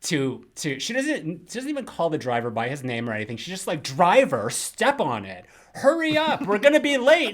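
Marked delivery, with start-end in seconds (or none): 3.8-7.3 high, loud, impatient mock command; bossy order. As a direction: hurry the driver along, entitled. Doctor bossing cab driver.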